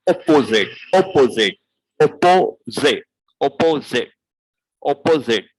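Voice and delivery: Cockney opposite; glottal T opposite. Shown as a demonstration. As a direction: cut the T in opposite. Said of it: "we do not even pronounce T".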